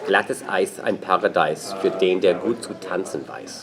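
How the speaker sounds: German. Shown as a demonstration. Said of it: "That's, that's Nietzsche".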